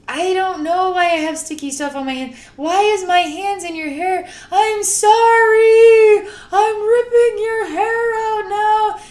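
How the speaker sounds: high, loud, crying wail that slows on the sorry; panicked apology. Retold or imitated. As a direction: wail it out in distress. Apologizing to woman in vision.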